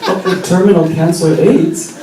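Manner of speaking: fortune teller. Pretend. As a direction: play the mystic reading a palm for the little one.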